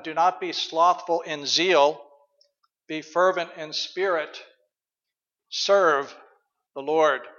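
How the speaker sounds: slow, deliberate verse reading; scripture reading. Acted. Pastor reading Romans twelve to the congregation, slow and deliberate. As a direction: read each phrase slowly.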